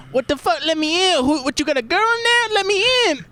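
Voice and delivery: very high, squealing mock yell; hammed-up high-pitched woman's holler; imitating a loud woman. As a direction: shriek it like she's demanding entry. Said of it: "a female, a woman saying this really loud".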